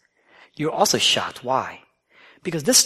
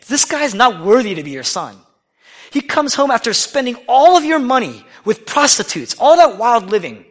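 high, loud outraged rant; indignant son; 7 semitones higher, 6 dB louder than usual. Acted. Elder son protests to his father, high and loud. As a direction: rant at the unfairness.

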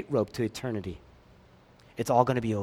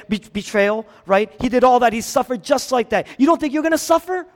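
loud, climbing higher with each line; preaching at full force; 6 semitones higher, 5 dB louder than usual. Acted. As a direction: build the challenge to a peak. Rising preaching run.

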